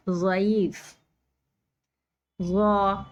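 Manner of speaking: ज़ा said full and thick, tongue to the side; emphatic ज़ा from the side of the tongue; Arabic zad; heavy zad. Shown as a demonstration. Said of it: "इस तरह से ज़ाद की आवाज़ निकलती है".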